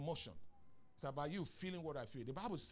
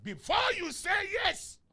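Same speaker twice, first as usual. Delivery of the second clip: much higher, louder and slow; preaching force; 12 semitones higher, 12 dB louder, slower than usual. Real, not acted. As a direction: lean hard on the warning to the women. Preaching warning to women.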